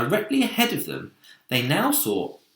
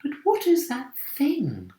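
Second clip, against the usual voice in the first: ladybird voice. A narrator's reading. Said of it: "whispered the ladybird".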